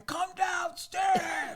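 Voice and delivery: high, slow mock shout up at a window; mock yell. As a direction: bellow it like a drunk at four in the morning. Imitating drunk ex yelling at window.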